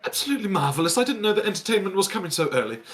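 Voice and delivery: high, fast, gushing posh lady; lady with purse praising the entertainer, fast and high; delighted lady. Acted. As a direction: gush like an impressed society lady. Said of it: "she's like".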